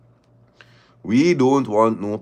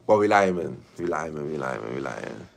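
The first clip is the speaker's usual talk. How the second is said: each "We lying" softer and lower until it is almost gone; trailing off while repeating "We lying"; fading out; 9 semitones lower, 5 dB quieter than usual. Real, not acted. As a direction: let it fade away, lower and softer.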